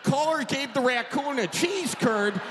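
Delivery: ordinary talk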